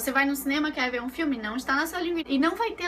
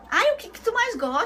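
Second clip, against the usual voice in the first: clerk voice; 8 semitones higher, 4 dB louder than usual. Retold or imitated.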